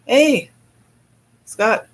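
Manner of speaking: calling out. Real, not acted. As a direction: call across the room. Calling out to Scott.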